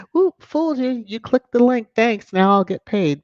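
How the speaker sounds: high, loud mock gloat, easing down toward the end; gloating clickbaiter. Media mouthpiece to tricked reader, mock gloating. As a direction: crow it like a trickster who got the click.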